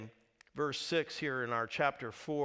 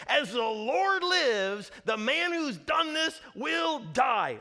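loud, slow, furious vow; angry king's oath; 9 semitones higher, 7 dB louder, slower than usual. Acted. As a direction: thunder it out as an enraged king. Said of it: "David's anger was greatly aroused".

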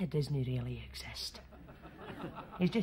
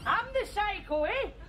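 much higher, unhinged rant; shrill psycho rant. Acted. Psycho lady ranting at the girl, much higher. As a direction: scream it out like a madwoman. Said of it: "step away from the psycho lady".

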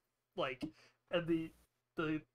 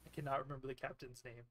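fast, hushed muttered aside; rushed aside; 4 semitones lower, 8 dB quieter, much faster than usual. Real, not acted. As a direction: hurry through it under your breath. GM aside, rushing.

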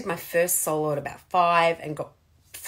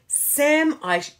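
self-scolding; 8 semitones higher, 5 dB louder, slower than usual. Retold or imitated. Scolding herself over failed test.

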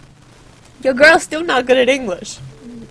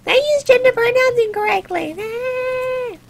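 mocking whiny tweeters; 11 semitones higher than usual. Imitating tweeters coming at her, mocking high whine. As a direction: squeal it like an outraged online critic. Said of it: "all the tweeters coming at me being like".

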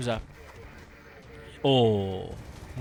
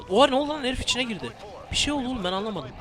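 high, fast shocked outcry; what just happened, stunned; startled outburst; 10 semitones higher, faster than usual. Real, not acted. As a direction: blurt out in disbelief. Surprised by enemy clipping.

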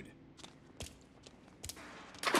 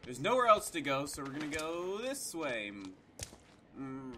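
ordinary talk

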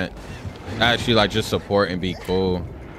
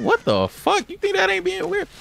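high-pitched retold disbelief; high, exasperated mock outburst; incredulous comeback. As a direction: act out a baffled retort, voice shooting up. Retold argument, his incredulous comeback.

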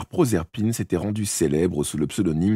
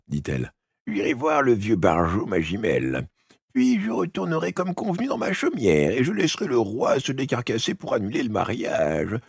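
put-on old witch voice; 5 semitones higher than usual. The witch replies.

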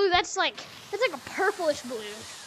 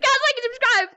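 frantic mid-chase plug; 5 semitones higher, 5 dB louder than usual. Real, not acted. Plugging channel mid-chase.

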